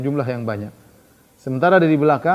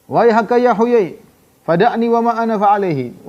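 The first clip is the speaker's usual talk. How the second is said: dismissive rebuke; 7 semitones higher, 5 dB louder than usual. Retold or imitated. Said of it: "Ka'ab dismissing Huyai".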